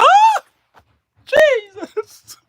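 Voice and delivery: very high, loud scream; terrified scream. Retold or imitated. Passengers screaming as the plane slams down, very high. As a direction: shriek at the jolt.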